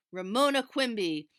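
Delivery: loud, stern call of her full name; a father warning his child. A narrator's reading. Father calling daughter back to pick up crayons, stern. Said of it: "her father said sternly".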